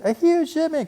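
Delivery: very high, loud cry; cried excitedly. A narrator's reading. Sister cub crying out to Papa, very high and thrilled. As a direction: squeal it out. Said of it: "cried Sister".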